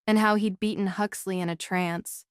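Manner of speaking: plain reading voice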